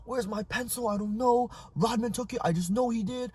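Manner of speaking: put-on high-pitched whiny kid, not truly shouting; put-on high tattling voice; mock kid yelling. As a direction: imitate an upset child accusing a classmate. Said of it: "He starts yelling at the teacher".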